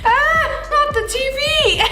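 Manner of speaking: very high, drawn-out lament; squeaky hammed-up distress; mock dismay. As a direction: wail it in pretend horror. Mock alarm in a joke.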